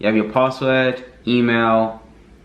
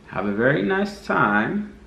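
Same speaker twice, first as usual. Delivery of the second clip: slow mock-foreign catchphrase, pitched up; Borat; 4 semitones higher, slower than usual. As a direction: stretch it out in a higher Borat-style voice. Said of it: "That was my Bora voice".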